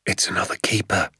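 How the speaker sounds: hushed whispered warning; father alerting son to the keeper, whispering. A narrator's reading. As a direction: whisper so the keeper won't hear. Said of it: "he whispered".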